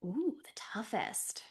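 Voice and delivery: hushed, slow thinking aloud; pondering whisper. Real, not acted. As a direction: trail into a whisper while thinking it over. Pondering a hard question to herself.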